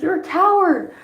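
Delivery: high, loud retold outburst; mock accusing cry. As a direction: act out a son calling out his father, voice soaring. Son accuses father of cowardice.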